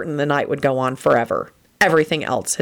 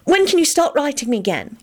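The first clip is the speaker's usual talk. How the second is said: high, fretting question; plaintive girl's complaint; fretful; 11 semitones higher than usual. A narrator's reading. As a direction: fret in a raised pitch. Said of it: "Elizabeth said fretfully".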